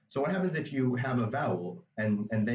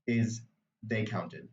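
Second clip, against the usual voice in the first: usual speaking voice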